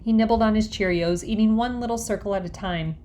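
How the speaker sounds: plain reading voice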